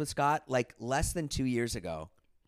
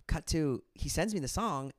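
ordinary talk

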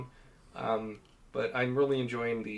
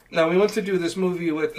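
put-on a half-hearted Swedish accent, a little higher and louder; attempted Swedish accent; 5 semitones higher, 6 dB louder than usual. Retelling the Swedish director's pitch in a weak Swedish accent. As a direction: try a Swedish accent and give up on it. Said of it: "I can't do an accent like that".

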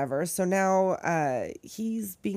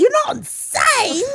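much higher and louder, slow and strained; mock doctor voice, pitched way up; hammed-up high quote; 8 dB louder than usual. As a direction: squeal it like a cartoon doctor. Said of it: "You may be killers".

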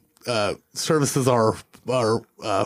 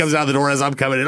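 normal talking voice

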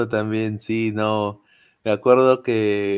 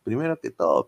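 usual speaking voice

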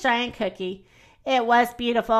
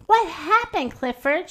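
worried question; 6 semitones higher than usual. Acted. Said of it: "she asked".

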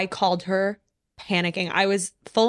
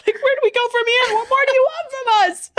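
much higher, louder, fast through laughter; much higher, laughing, overjoyed at their luck; giddy laughing; 5 dB louder than usual. Real, not acted.

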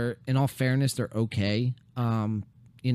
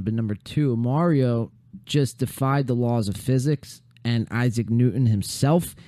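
ordinary talk, nothing marked

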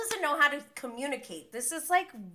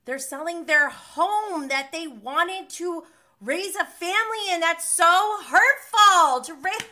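high, slow, increasingly loud outpouring; impassioned distress; 6 semitones higher, 9 dB louder than usual. Real, not acted. Host upset about the couple selling their home, impassioned. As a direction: build to a loud, pained protest.

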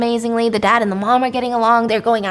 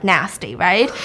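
ordinary talk

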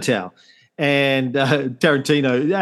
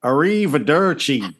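put-on Italian accent. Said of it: "And really bad Italian accent".

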